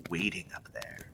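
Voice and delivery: sudden soft ominous drop; spindly man ending softly; ominous hush. Acted. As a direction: let it fall to a creepy hush. Menace trails into hush.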